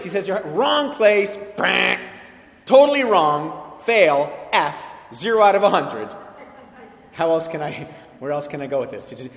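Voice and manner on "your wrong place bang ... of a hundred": slow, loud, hammering each word; slow loud scolding rebuke; blunt loud verdict. Retold or imitated. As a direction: pound out the failing grade. Paul's blunt verdict voiced.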